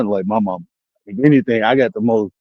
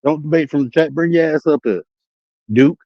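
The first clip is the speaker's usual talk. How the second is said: normal talking voice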